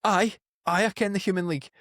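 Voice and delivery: high, thrown reply, caught off guard; a startled woman suddenly answering a question; arrested girl confused by the odd question, high and surprised; baffled girl. Retold or imitated. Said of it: "And she's like".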